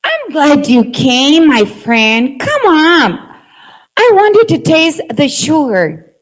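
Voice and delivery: welcoming sugar ant. Acted. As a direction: greet your friend with open arms. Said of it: "make different voices".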